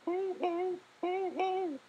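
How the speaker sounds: frightened cry. Acted. Little Mouse crying to Big Mouse about a ghost, frightened high call. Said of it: "he cried".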